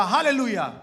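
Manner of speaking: imitated outcry. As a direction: echo a worshipper's sudden cry. Interpreter echoes the congregant's outcry. (जयकारे की हल्की नकल)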